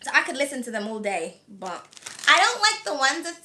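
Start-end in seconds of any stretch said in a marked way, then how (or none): none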